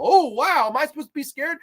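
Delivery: high, mock-impressed jeer; sarcastic awe. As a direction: pretend to be amazed, scornfully. Mocking the film's scare.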